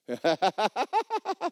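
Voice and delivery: high staccato put-on laugh; hammed-up high cackle, on and on; Kenneth Hagin. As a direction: imitate a taunting laugh in rapid bursts. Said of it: "Kenneth Hagin went".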